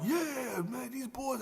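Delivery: high-pitched hyped praise; big up the new crew; impressed praise. Retold or imitated. [name] praising the boys after their set, high hyped voice. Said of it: "[name] out like".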